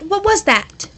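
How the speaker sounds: ordinary delivery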